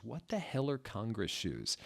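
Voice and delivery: usual speaking voice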